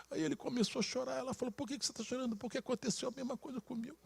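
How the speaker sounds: high, hushed, emotional telling; choked-up retelling. Retold or imitated. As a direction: tell it softly and high, close to tears. Retelling the husband breaking into tears.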